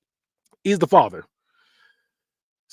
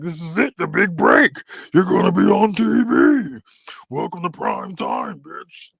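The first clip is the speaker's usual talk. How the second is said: louder, slow mocking Freddy taunt; a hint of a gloating killer showman; 4 semitones higher, 4 dB louder, slower than usual.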